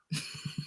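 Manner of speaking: hushed, voiceless exhale; whispered sigh of a reaction; breathy "ah". Acted. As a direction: let out a very soft, airy "ah". His silent reaction to her.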